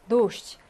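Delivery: 도시 said clearly with stress on the first 오; 도시 sounded out for the class; pronunciation demo. Shown as a demonstration. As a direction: model 도시 slowly and distinctly with the first syllable stressed. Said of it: "우리가 발음을 도시라고 읽는데".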